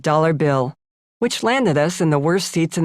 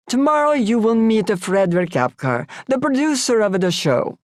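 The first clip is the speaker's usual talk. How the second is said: put-on Italian accent; 5 semitones higher than usual. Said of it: "Sandro said".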